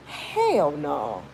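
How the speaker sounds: normal talking voice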